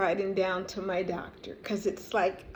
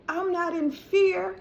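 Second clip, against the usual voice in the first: raised, insistent talking to her own mind; firmly commanding her body to calm down; firm self-talk; 6 semitones higher than usual. Retold or imitated. Herself ordering her subconscious mind, firm raised self-talk. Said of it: "And I go".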